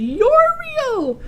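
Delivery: high, loud joyful cry; joyful shout. A narrator's reading. Santa jumping up from behind sofa, joyful shout. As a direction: burst out with pure joy. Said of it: "he shouted".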